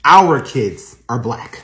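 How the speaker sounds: firm correction. Acted. Wife firmly corrects the racist.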